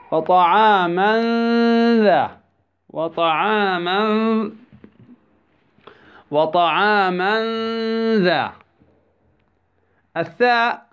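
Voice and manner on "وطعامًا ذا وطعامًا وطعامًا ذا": وطعاما ذا slow, the tanween drawn into a nasal hum; ikhfa of tanween. Shown as a demonstration. Tajweed teacher gives a tanween example, long humming ikhfa in وطعاما ذا. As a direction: hold the hidden n between وطعاما and ذا. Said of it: "مع التنوين".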